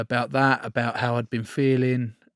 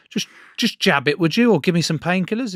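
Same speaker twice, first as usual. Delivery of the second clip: rising, louder, fed-up plea to the doctor; exasperated outburst; 7 semitones higher, 6 dB louder than usual. Retold or imitated. Player telling the club doctor to just jab his hand, exasperated loud plea. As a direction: snap at him to just jab it and move on. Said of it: "I went".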